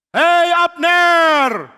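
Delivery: long, high, drawn-out holler; full-voiced, stretched calling out; teasing shout. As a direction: holler to wake a distant sleeper. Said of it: "Dia mulai teriak".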